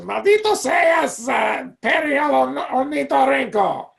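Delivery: high, shouted villain voice in Spanish; Doofenshmirtz in Spanish. Pretend. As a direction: rage at the platypus like a cartoon villain. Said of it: "ask you to do Doofenshmirtz in Spanish".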